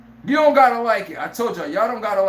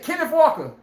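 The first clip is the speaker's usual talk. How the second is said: excited call; 7 semitones higher than usual. Retold or imitated. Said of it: "Sam Darnold said".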